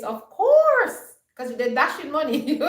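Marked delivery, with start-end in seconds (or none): 0.0-1.0 high, loud mocking "Of course"; sarcastic of course. Real, not acted. Vlogger scoffing at men who hand out money, sarcastic high jeer. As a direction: toss it off with big sarcasm.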